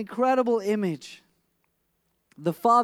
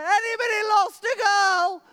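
a neighbour shouting to find the parents; calling out; 11 semitones higher than usual. Retold or imitated. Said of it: "this woman comes around going".

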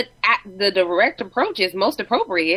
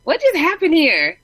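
baffled; 9 semitones higher, 5 dB louder than usual. Retold or imitated. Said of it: "and I'd be like".

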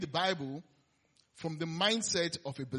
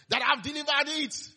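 higher, louder mock delivery guy cheering; mock delivery man; 9 semitones higher, 6 dB louder than usual. Preacher mocking a pizza man celebrating, higher and louder. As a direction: play a delivery man gloating over a pizza.

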